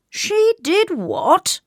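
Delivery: high, drawn-out royal disbelief; high-pitched appalled king; shocked cry. A narrator's reading. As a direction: cry out slowly, utterly scandalised. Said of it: "cried the king".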